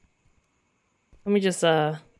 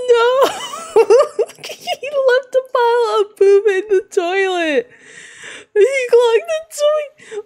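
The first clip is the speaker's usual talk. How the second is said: high squealing wail through laughter; hysterical disgust; 12 semitones higher than usual. Real, not acted. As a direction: cry out, cracking up with horrified laughter. Hysterics at the clogged toilet.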